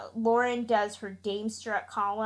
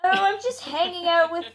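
hammed-up British accent, higher and louder; exaggerated British accent; 7 semitones higher, 5 dB louder than usual. Podcast host playing up her week's plans, mock British accent. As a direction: put on an over-the-top British voice, half laughing. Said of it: "really exaggerated British accent".

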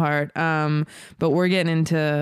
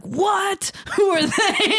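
surprised; 14 semitones higher, much slower than usual. Retold or imitated. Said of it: "I'm like".

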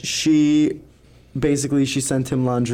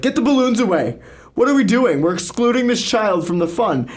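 boss yelling; 8 semitones higher, 3 dB louder than usual. Retold or imitated. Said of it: "my boss came in and yelled".

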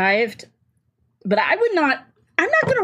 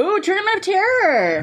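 spooky announcement; 11 semitones higher than usual. Real, not acted. Savoring a spooky title.

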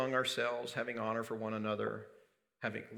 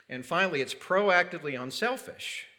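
usual speaking voice

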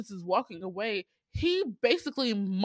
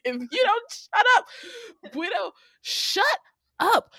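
exasperated mock threat; 10 semitones higher than usual. Viewer scolding Guido for antagonizing everyone, exasperated. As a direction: raise the pitch and drag out the last words. Acting her angry reaction to Guido.

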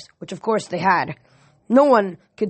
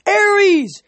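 high, loud battle cry; shrill shout; 12 semitones higher, 5 dB louder than usual. A narrator's reading. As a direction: shriek the war name. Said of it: "shouted in that strangely chill, shrill voice".

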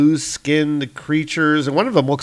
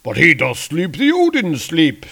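higher, theatrical warrior voice; Volstagg; 6 semitones higher, 4 dB louder than usual. Acted. Warrior warning his comrades of the Odin sleep, theatrical. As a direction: protest in grand old-fashioned style. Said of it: "says Volstagg".

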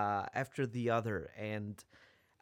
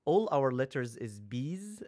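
ordinary talk, nothing marked

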